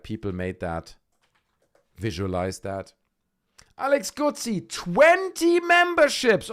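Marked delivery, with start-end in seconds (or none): 3.8-6.5 very high, slow, louder sing-song; high drawn-out hype announcement; high sing-song announce. Acted. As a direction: stretch the name and number out like a fanfare. Announcing gifted memberships.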